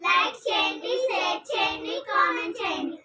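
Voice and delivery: chirpy channel goodbye; vlog outro. Acted. (Video ముగింపు)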